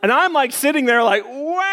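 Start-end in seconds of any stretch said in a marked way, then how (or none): 1.2-1.7 high, drawn-out cartoon "wah"; mock bawling wail. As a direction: bawl like a little kid, hammed up. Acts himself crying after the punch.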